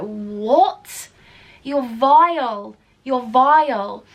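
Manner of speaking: loud, slow, stretched-out revulsion; disgusted outburst. Retold or imitated. Doorwoman facing gurning drugged men, loud appalled disgust. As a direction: draw out the insult, appalled.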